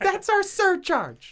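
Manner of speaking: high, drawn-out comic rant; Bill Burr. Acted. Comedian riffing on his own rant, mock outrage. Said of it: "it was my Bill Burr rant".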